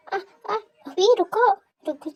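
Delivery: breathless pained pleading to stop; pained gasps. Acted. Wife gasping at her husband to stop. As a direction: gasp and beg him to wait.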